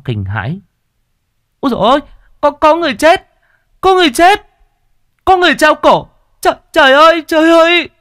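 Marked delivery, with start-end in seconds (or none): 1.6-7.9 much higher and louder panicked cry; frightened boy yelling about a hanged body; terrified shout. A narrator's reading. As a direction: scream it out in horror. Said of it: "đột nhiên hoảng sợ".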